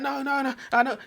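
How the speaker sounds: high-pitched joking protest; high, hammed-up pleading; mock protest. Joking protest of wounded soldier.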